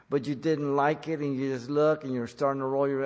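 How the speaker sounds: usual speaking voice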